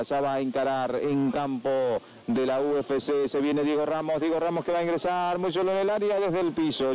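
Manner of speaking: normal talking voice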